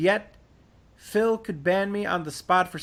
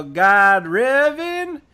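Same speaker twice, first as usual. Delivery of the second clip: normal talking voice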